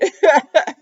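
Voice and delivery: short burst of loud laughter. Real, not acted. Laughing at her own joke.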